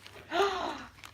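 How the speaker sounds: sharp gasp of surprise; amazed gasp. Real, not acted. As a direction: gasp at what is inside. Opening a surprise food delivery.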